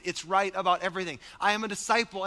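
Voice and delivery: ordinary talk, nothing marked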